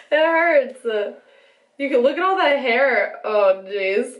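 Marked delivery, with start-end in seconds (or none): 0.1-0.8 laughing in pain. Real, not acted.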